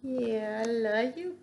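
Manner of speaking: ordinary talk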